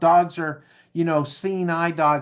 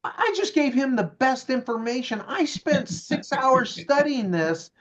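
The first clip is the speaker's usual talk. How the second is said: smug indignation; 7 semitones higher than usual. Retold or imitated. Narrator's smug inner voice.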